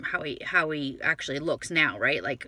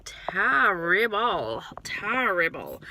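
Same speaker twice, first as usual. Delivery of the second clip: long, slow "terrible", said twice for effect; stretched-out terrible; much slower than usual. Real, not acted. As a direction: draw out "terrible" slowly, swooping. Complains about lighting, drawn out.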